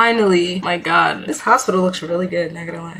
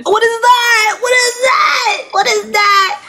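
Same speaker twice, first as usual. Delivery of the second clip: much higher, crying mock shout; hammed-up horror shriek; 11 semitones higher than usual. Patient shrieking at surgery horror, much higher crying mock shout. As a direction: shriek it like a horror movie victim. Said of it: "I'm such a good actor".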